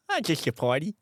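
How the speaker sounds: heavily lisped prankster voice; put-on lisp. Joker chatting up friends in the Uber, lisping.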